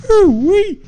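very high, loud mock whoop; imitated whoop. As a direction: squeal it out like a startled onlooker. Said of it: "and he's like".

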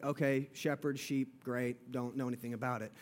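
low, soft, offhand shrug of a voice; dismissive inner thought. Retold or imitated. Preacher voicing a careless listener, low and offhand. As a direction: toss it off like it means nothing. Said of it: "and just think".

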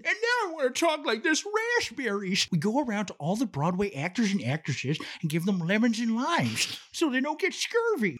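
goofy diva voice with slurred s sounds; silly put-on voice. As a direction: ham it up in a silly stage voice. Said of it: "Now I can't stop talking like that".

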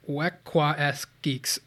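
Wekwaskgeeks drawn out very slowly; another attempt. Shown as a demonstration. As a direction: stretch Wekwaskgeeks carefully. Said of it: "One more time".

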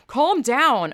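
mock condescending "calm down".

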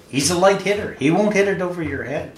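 mock-shout it out to a fielder; imitated heckling shout. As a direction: call out like a ballpark heckler. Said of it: "he's shouting at".

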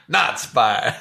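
mock verdict voice. Echoing Henry's classification of people, in a low mock verdict.

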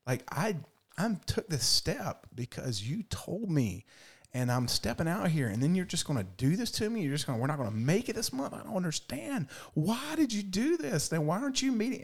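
high, pleading prayer climbing toward a wail; imitating her own crying whine at God; retold tearful plea. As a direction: plead with God as if fighting back tears. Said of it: "I remember driving my car crying".

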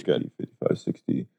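low unvoiced count under breath; whispered counting. Real, not acted.

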